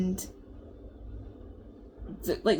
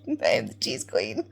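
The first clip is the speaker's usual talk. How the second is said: lower, flat-voiced restatement of the title; deadpan repeat; 10 semitones lower than usual. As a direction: say it dead serious, unbothered. Repeats the claim to the friend.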